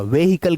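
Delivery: व्हीकल said "ve-hi-kal" with sounded H; wrong pronunciation. Shown as a demonstration. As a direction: give व्हीकल three syllables with an audible H. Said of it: "गलत है".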